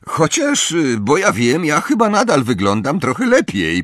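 high, lively joking voice; teasing twin. A narrator's reading. Fred admiring himself in a kettle, playfully teasing. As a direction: pitch it high, hesitate, then tease. Fred jokes about his new looks.

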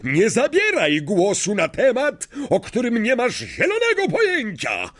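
high, furious outburst; fit of rage. A narrator's reading. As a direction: explode as if seized by madness. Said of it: "jakby nagle dostał ataku szału". (wybuch szału, wysokim głosem)

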